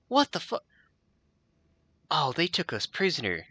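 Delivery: high, frantic whine; trapped and losing it; panicked prisoner. Acted. Prisoner panicking to Ron, high and frantic.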